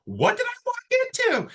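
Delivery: high squeaky startled question; shocked. Retold or imitated. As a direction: act baffled, voice jumping up. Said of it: "And you're like".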